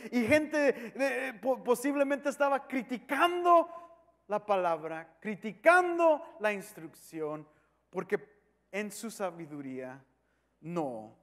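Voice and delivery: normal talking voice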